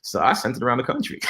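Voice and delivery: normal talking voice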